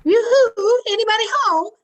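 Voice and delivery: high, loud singsong yoo-hoo; neighborly holler, pitched right up; cheery calling out. Acted. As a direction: call into the house on arrival. Neighbor calls out on arrival.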